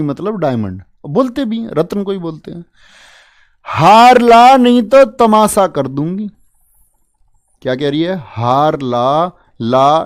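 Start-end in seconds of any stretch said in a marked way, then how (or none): 3.7-6.3 loud, slow, high scolding demand; a wife shrieking her ultimatum; demanding bhabhi. Acted. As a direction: threaten him like an angry sister-in-law. Bhabhi's demand acted in story.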